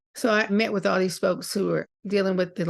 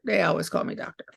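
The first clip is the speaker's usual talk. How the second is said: ordinary talk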